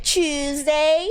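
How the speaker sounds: "Tuesday" drawn out slowly in an English accent; mock English accent, stretched vowels; attempted English accent. Shown as a demonstration. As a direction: try a questioning English "Tuesday". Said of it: "But how do I say other things?".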